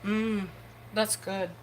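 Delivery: talking through a mouthful of tortilla; chewing while trying to speak; mouth full. Real, not acted. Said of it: "Sorry, I had my mouth full".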